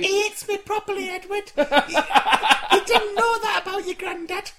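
high grandma scolding; Doreen old lady voice. Pretend. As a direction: nag high like an old wife.

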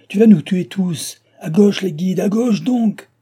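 loud, high, furious shouted orders; frantic high-pitched command; urgent shout. A narrator's reading. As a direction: yell at the driver to save your lives. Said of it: "cria-t-il".

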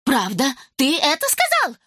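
high, merry question; cheerful high-pitched teasing; amused. A narrator's reading. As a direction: ask it in delighted disbelief. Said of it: "развеселилась Симонна".

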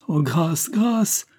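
high-pitched cry for mercy, climbing sharply; pleading cry. A narrator's reading. Woman clinging to her would-be killer, crying out for mercy. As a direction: beg desperately, voice leaping up. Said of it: "s'écria-t-elle".

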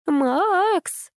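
high, drawn-out yell; straining call. A narrator's reading. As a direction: call him at a high pitch, insistently. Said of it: "надрывалась Капа".

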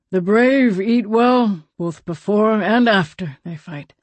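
lightly voiced slow old man; a hint of a weathered elder; old man Grant.

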